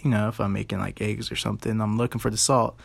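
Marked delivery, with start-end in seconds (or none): none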